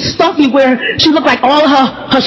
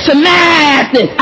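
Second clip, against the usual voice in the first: high, long-stretched name call; Grandma calling names; 3 semitones higher, much slower than usual. Retold or imitated. Grandma miscalling little sister's name, long drawn-out call. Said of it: "it came down to Samantha".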